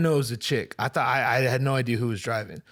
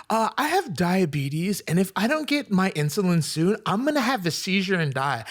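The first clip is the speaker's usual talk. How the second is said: mock her plea in a raised voice; complaining woman driver; 6 semitones higher than usual. Driver pleads her diabetes to him, higher mocking imitation. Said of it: "And she's like".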